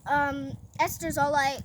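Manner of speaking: ordinary talk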